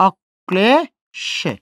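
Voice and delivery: অক্লেশে drawn out very slowly, অক then লেশে; slow syllable demo. Shown as a demonstration. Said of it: "তার সাথে সেই লটা আমরা যুক্ত করে দিচ্ছি". (অক্লেশে খুব ধীরে, ক দুইবার উচ্চারণ করে)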